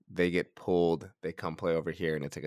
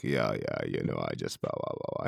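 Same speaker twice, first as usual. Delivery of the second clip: low, softening blah-blah mumble; mock casual chatter; 12 semitones lower, 5 dB quieter than usual. Host mimicking their chat before recording, low and offhand. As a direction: imitate idle off-mic chat, dropping right down. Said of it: "And we were just talking".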